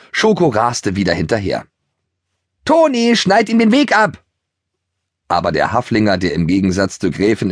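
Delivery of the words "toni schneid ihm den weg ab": high, loud shout across the meadow; calling out. Acted. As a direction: call out to your friend to cut him off. Schoko calls across meadow.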